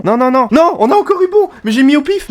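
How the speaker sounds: cri de dépit très aigu; high, incredulous wail of protest; protestation affolée. Real, not acted. Mauvaise réponse donnée par hasard. (protestation paniquée, voix très aiguë)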